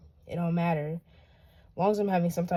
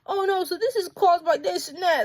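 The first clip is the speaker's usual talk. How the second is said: higher mock voice, dismissive; mocking skeptic; 8 semitones higher than usual. Mocking people who explain creation away, higher sneering voice. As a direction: parrot the doubters in a higher sneering voice. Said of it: "we can make up false things and just like".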